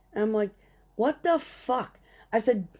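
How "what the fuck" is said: high, slow, stretched out; disbelief. Retold or imitated. Driver reacts to the kid's question, incredulous. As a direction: let the disbelief hang.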